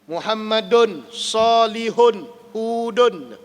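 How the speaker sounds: slow Quranic recitation of Muhammadun Salihun Hudun with each -un ending drawn out; tanwin recited. Shown as a demonstration. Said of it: "ada yang menerima baris dua".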